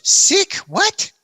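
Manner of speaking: high, loud startled "Sick? What?"; the buddy caught off guard, squeaking it back; shocked disbelief. Retold or imitated. Boat partner hearing his friend is sick, high and shocked. Daisuke baffled by sick claim.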